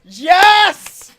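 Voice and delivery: loud, high excited rallying; mock recruiter. Host recruiting listeners to B S Army, mock rousing call. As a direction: shout it like a recruitment pitch.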